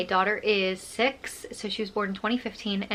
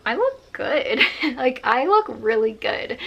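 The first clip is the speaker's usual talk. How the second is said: pleased, self-admiring; 3 semitones higher, 3 dB louder than usual. Retold or imitated. Admiring her own photo.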